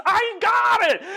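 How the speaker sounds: very high-pitched exultant yell; triumphant cry. Acted. Sav turns round holding the ball, crying out high. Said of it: "he goes".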